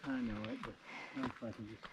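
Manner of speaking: usual speaking voice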